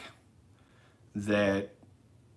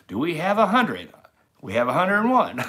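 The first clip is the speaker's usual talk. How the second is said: higher, louder auctioneer call; 8 semitones higher, 7 dB louder than usual. Pretend. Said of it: "I sound like an auctioneer there for a second".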